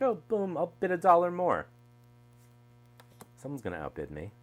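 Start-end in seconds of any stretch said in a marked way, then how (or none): 0.0-1.7 high, quick, gleeful bidding voice; mock eager bidder. Mocking her own eager eBay bidding, high and quick. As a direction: play the impulsive bidder grabbing a deal.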